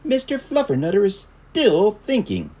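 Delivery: high, loud firm mom; high mother voice. A narrator's reading. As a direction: tell them firmly he stays put. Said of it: "answered Mama Junebug Jones".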